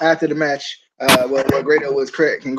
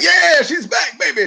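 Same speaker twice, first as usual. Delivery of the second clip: higher-pitched, hammed-up announcer cheer; mock hype; 10 semitones higher, 3 dB louder, slower than usual. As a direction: sell her return like a fake hype promo. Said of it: "they like".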